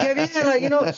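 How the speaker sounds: high-pitched rowdy Spanish cheer; high, excited exclamation; back-home hype. Acted. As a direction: mimic a hyped-up homeboy. Continues back-home talk impression.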